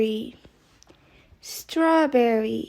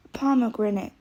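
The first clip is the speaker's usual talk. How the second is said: ordinary talk, nothing marked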